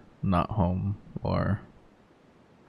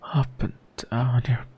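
intimate whisper; 4 semitones higher than usual. Acted. Intimate whisper, about to be interrupted.

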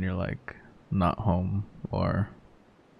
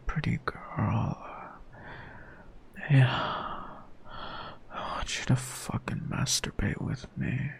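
sensual whisper close to the mic; right in your ear; 5 semitones higher, 7 dB quieter than usual. Acted. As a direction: whisper it like she's right beside you. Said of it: "whispering in your ear".

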